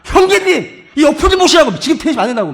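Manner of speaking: loud, high call to a fellow member; imagined urgent shout. Pretend. Preacher calling a church member off court, acted shout. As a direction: shout a bossy warning across the court.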